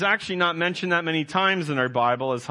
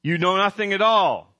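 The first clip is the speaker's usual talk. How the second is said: loud, blunt put-down to the council; rude and blunt; 2 semitones higher, 4 dB louder than usual. Acted. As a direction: scorn them as fools. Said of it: "how Caiaphas speaks to the council".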